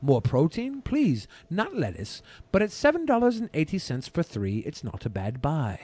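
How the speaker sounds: measured, deliberate review narration; a hint of a British accent in the read; food review voiceover. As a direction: read it like a taste-test video host. Reads his food review voiceover.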